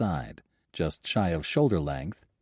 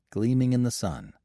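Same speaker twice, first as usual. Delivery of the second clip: usual speaking voice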